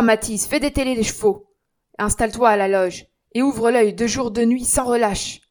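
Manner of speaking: loud, commanding scolding; stern dismissal. Acted. Jean firing the concierge, loud stern orders. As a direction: lay down the law.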